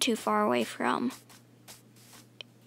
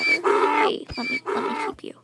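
much higher, squeaky begging voice; squeaky pleading; 4 dB louder than usual. Real, not acted. Begging donkey to stay.

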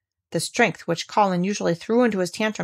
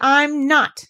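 higher, louder furious denial; boy insisting in anger; furious cry; 6 semitones higher, 5 dB louder than usual. Acted. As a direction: snap it back. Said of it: "he cried out furiously".

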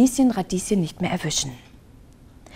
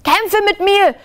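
shouting challenge; 12 semitones higher than usual. Acted. Lieschen shouting a challenge at the dragon. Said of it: "schrie Lieschen Radieschen".

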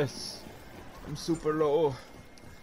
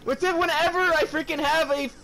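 very high, exasperated yelling; high-pitched gamer meltdown; frantic complaint; 10 semitones higher than usual. Real, not acted. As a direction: wail in frustration at being stuck. Stuck while a zombie attacks.

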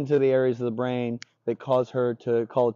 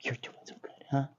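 hushed, whispered praise to a dog; whispered princess voice; 9 dB quieter than usual. Shown as a demonstration. Trainer competing for the dog's attention, whispered princess voice. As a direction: whisper sweetly, ending on a little "huh?". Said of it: "these extra princess voices that I'm doing".

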